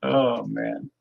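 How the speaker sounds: ordinary talk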